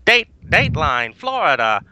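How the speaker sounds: put-on high announcer voice of old newsreels. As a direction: boom it out like a newsreel headline. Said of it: "That, that's perfect newsreel voice".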